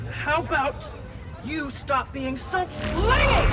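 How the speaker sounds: high, slow mocking jab; taunting. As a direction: drag it out to needle her. Taunting Phin mid-fight.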